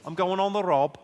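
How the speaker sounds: comic Scouse character talk; drawn-out Liverpool accent. Shown as a demonstration. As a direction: put on a hammy Scouse. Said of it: "authentically Scouse".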